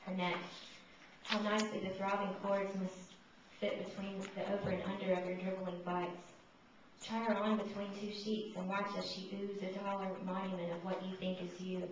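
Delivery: usual speaking voice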